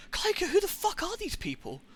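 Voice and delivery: retelling his lost confusion in a high, strained voice; incredulous high voice. Retold or imitated. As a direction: squeak out total bafflement. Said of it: "I'm just like".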